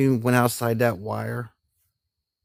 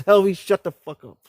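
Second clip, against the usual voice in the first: higher, imitated sergeant snapping at you; mock barked order; 7 semitones higher than usual. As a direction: play the sergeant shutting down a laughing soldier. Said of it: "He turns, looked at me".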